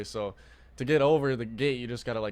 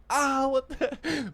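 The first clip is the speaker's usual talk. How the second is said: mock yelp; 13 semitones higher, much slower than usual. Kid yelping, confused.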